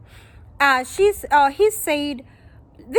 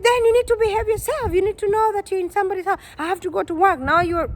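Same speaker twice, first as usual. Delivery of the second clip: much higher, fast scolding yell; imitating his yelling; 7 semitones higher, faster than usual. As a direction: scold her like a bossy host. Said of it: "whatever he was yelling at".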